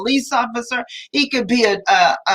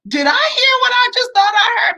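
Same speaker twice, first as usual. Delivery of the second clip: disbelief; 11 semitones higher than usual. Retold or imitated. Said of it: "and it was like".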